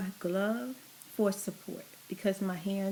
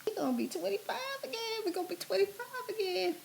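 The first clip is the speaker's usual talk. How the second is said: squealing with excitement; high squeal; 11 semitones higher, faster than usual. Real, not acted.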